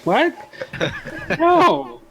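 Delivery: high, loud alarmed outburst. Acted. [name] reacting in character, alarmed.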